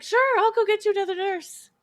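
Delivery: high, sugary fake-polite voice; sweetly sarcastic send-off; fake cheerful nurse. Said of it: "we were always like".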